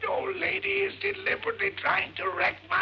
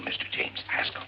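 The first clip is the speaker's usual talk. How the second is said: ordinary delivery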